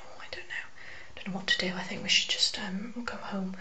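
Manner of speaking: meek, hushed imitation of a girly girl; girly-girl friend. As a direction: imitate a timid friend, half-whispered and very soft. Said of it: "one of the girly girls".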